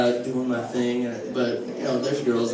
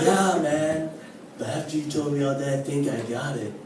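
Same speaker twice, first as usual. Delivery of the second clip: stoner friend voice; 5 dB quieter than usual. Retold or imitated. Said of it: "he does talk like this".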